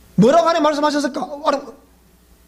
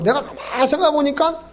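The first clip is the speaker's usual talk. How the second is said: ordinary delivery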